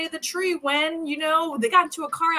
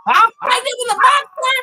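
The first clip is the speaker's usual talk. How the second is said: much higher, louder hysterical shout; furious scream; 13 semitones higher, 9 dB louder than usual. Retold or imitated. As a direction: scream at the husband in despair. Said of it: "she's like".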